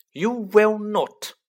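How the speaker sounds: high, loud, drawn-out refusal; snapped. A narrator's reading. Mother cutting off son's plan for bed, snapped. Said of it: "snapped Missus Weasley".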